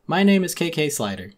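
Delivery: easygoing musician introducing himself; lightly voiced mellow dog; a hint of K.K. Slider.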